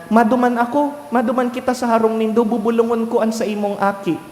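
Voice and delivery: the Lord eager. Retold or imitated. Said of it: "Sabi kan kagurangnan".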